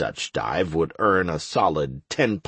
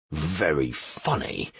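low, soft sarcastic growl; 6 semitones lower, 6 dB quieter than usual. A narrator's reading. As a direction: growl it back, much lower. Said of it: "growled the rogue".

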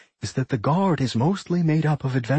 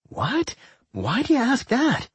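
high startled what; 12 semitones higher than usual. A narrator's reading. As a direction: jump up in pitch, caught off guard. Doc startled by accusation.

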